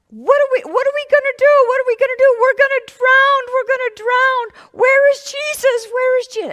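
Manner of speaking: high, loud frantic cries of fear; panicked cry. Acted. Disciples in the storm crying out to each other, panicked and loud. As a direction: cry out as if the boat is sinking.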